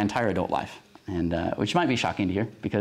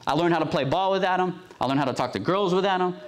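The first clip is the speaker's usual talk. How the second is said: mad, pretending not to be; 6 semitones higher than usual. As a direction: act out a hurt boy insisting he doesn't care. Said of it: "Will gets mad, like, he gets mad, pretending he's not mad".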